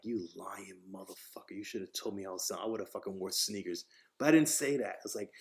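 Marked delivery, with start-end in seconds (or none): 0.0-3.9 low, very soft inner voice; private grumble. Retold or imitated. New hire silently cursing the interviewer, muted grumble. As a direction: grumble it under your breath.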